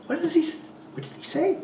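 high, bewildered inner what, what, what; baffled inner voice. Retold or imitated. To himself, confused by the runner's speech, high and bewildered. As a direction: pitch up into comic bewilderment, then break into a laugh.

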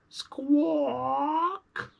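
put-on very high shrill baby-dragon squawk; storyteller imitates a little dragon squawk. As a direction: screech like a tiny dragon.

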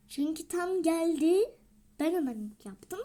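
usual speaking voice

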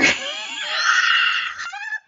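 high breathless shriek of laughter; squealing laugh. Real, not acted. Reacting to chat's dirty joke.

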